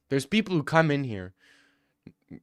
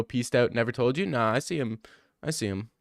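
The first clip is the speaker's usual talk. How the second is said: usual speaking voice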